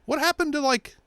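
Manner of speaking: high squeaky no, no; lightly voiced flustered whine; mock high protest. Mocking himself to viewers, squeaky no.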